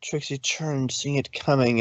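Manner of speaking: plain reading voice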